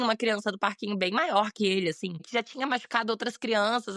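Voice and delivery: ordinary delivery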